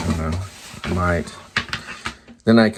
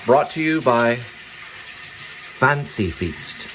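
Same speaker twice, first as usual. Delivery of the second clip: mock ad tagline; 3 semitones higher, much slower than usual.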